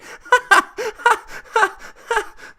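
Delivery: laughing helplessly, high-pitched; high laughing fit. Real, not acted. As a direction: break into high cackling laughter. Laughing at stats.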